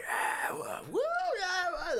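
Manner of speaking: high-pitched crowd whooping; rowdy, excited crowd cheers; raucous cheering. Acted. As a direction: whoop like a rowdy mob of guards. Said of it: "it starts getting raucous".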